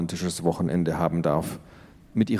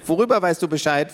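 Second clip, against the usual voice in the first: put-on high, curious little girl; a six-year-old barging in with a question; 8 semitones higher than usual. A narrator's reading. Tiffany asks the teens, in a high child voice.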